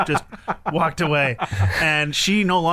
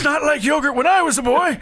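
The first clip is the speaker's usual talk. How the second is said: lightly voiced old-timer, pitched up high; mock old-timer; 10 semitones higher, 4 dB louder than usual. Dad joking about new yogurt, mock old-timer voice. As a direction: grumble higher, like a hint of an old codger.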